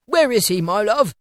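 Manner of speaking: lightly voiced kindly old man; genial Scrooge. A narrator's reading. Scrooge asks the maid.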